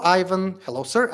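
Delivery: usual speaking voice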